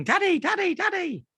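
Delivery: put-on high-pitched little-boy cry; imitated child calling. Imitating his son crying out from bed, alarmed. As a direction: call for Dad like a frightened child. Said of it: "I hear him going".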